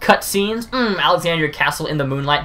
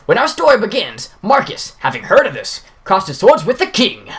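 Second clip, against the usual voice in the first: grand stage announcer, raised pitch; Baku voice; 4 semitones higher than usual. Acted. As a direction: present the play to the whole hall. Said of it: "my Baku voice".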